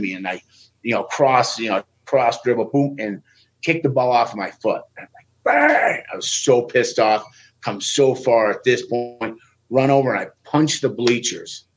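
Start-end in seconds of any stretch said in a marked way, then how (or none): 5.4-6.0 high, sharp "Bang!" burst; mock bang. Wrestler reliving the ball kicked away, a sharp high bang. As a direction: blurt it like an explosion.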